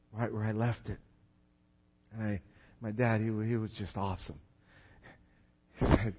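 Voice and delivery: ordinary talk, nothing marked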